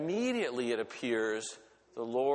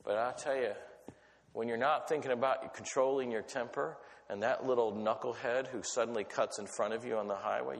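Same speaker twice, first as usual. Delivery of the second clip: ordinary talk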